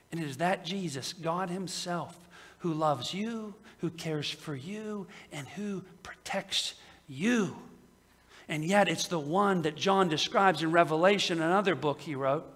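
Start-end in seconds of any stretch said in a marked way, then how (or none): 0.1-7.6 tender. Real, not acted. Tender aside to the congregation.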